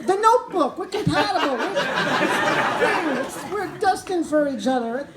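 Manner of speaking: the smitten man argues with his brain, giddy insistence; giddy self-defense. Retold or imitated. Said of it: "And I'm like".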